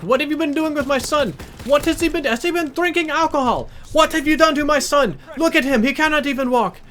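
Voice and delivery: high, loud imitation of an angry Indian mother; Indian-accented screaming. Angry mother confronts her son's friend, shrill mock Indian accent. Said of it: "going at me with this kind of accent".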